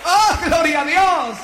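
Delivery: high-pitched, fervent proclamation; exultant pulpit cry, pitched high; impassioned preaching. Real, not acted. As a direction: preach it in ringing, separated phrases. Preaches at full force.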